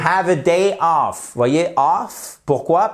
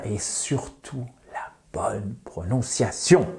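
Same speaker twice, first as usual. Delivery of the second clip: hushed emphasis; 3 semitones lower, 7 dB quieter than usual. Shown as a demonstration. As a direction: drop to a whisper for the key point. Emphatic aside on pronunciation.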